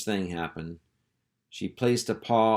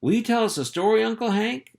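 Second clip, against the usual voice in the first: put-on much higher, fast child's plea; a pup begging for a story; eager pup; 13 semitones higher, 4 dB louder, faster than usual. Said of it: "asked Spot".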